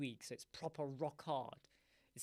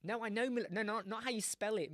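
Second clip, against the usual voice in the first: usual speaking voice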